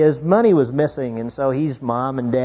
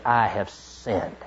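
hushed confession; 7 semitones lower, 10 dB quieter, much slower than usual. Retold or imitated. Confession quoted softly, slowly.

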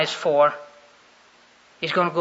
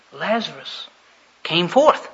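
ordinary talk

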